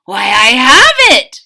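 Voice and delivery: loud triumphant cry; exclaimed. A narrator's reading. Cook struck by a bright idea, loud exclaim. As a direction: burst out with the idea. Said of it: "he exclaimed".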